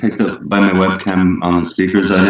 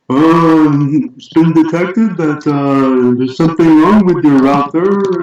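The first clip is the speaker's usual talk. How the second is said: higher-pitched, imitation Indian accent; attempted Indian accent; 8 semitones higher, slower than usual. Caller from the internet provider reporting a router fault, attempted Indian accent. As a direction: put on a shaky-fit Indian accent, pitched up. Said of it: "I'm trying to do an Indian accent".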